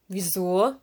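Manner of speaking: mildly puzzled question; astonished. A narrator's reading. Lanternfish taken aback by Serena being lost, astonished. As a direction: ask with understated surprise. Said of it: "Jetzt war der Laternenfisch erstaunt".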